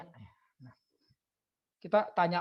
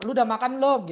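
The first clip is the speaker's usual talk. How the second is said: nagging mum; 5 semitones higher, 7 dB louder than usual. Retold or imitated. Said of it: "kayak emak kita gitu".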